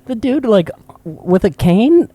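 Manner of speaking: put-on high-pitched kid, slowing at the end; hammed-up childish doubt; imitated incredulous child. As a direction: spell out the absurdity in disbelief. Child self incredulous at mom.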